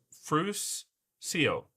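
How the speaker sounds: "Frus", pause, "seal"; split into syllables. Shown as a demonstration. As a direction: sound out "Frus ... seal" as two separate pieces. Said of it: "break it down into syllables".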